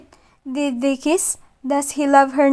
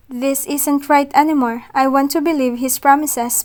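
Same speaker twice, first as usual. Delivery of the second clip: plain reading voice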